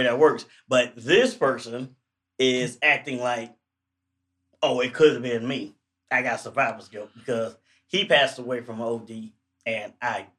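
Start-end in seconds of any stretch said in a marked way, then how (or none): none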